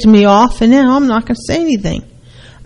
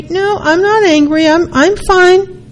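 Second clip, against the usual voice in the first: mock sulky denial; 10 semitones higher than usual. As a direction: insist you are fine while sulking. Acted sulky denial in dialogue.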